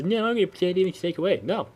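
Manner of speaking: high, whiny mock of a defiant kid; high mocking voice of a kid refusing to give up the game; bratty kid. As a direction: squeak it like a stubborn child. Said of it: "If your kid says".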